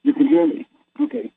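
muffled, like through a bad headset. Real, not acted. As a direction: speak as if the mic is covered. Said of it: "but you sound muffled".

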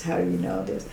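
whispered in disbelief; stunned whisper. Retold or imitated. Asking the praying old lady, a whispered disbelief. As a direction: breathe the question out, stunned.

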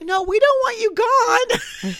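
high, slow, drawn-out protest; mock reassurance. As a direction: protest sweetly, high and stretched out. Acting the reassuring assistant, laughing.

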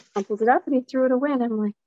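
ordinary delivery

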